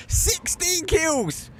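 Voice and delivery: very high, slow, stretched cry; very high, drawn-out hype call; ecstatic shout. Real, not acted. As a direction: yell it in sheer disbelief. Caster hypes huge kill count.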